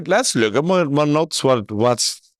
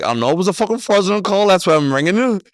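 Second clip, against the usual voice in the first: quick, higher, irritated snap; exasperated retort; 5 semitones higher, faster than usual. Retold or imitated. Caller snapping at the customer service man, fast and irritated. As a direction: fire it back, fed up.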